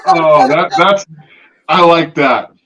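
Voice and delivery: words spilling out through real laughter; laughing reaction. Real, not acted. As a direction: laugh while you praise the joke.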